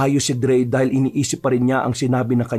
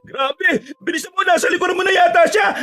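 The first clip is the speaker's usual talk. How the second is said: very high, frantic yell; alarmed, high-pitched shouting; panicked shout. Acted. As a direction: scream a warning as the monster closes in. Friend warns while fleeing monster.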